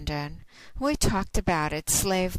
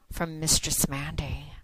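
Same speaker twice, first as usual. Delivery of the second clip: whisper. Acted. Signing off to listeners in a whisper. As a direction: whisper your name as a sign-off.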